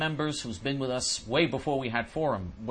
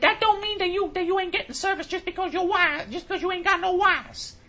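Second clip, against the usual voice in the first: imitating the supervisor; 4 dB louder than usual. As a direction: parody her as dismissive. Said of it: "She said".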